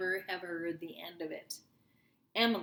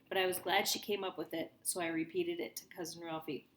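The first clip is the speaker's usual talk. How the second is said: plain reading voice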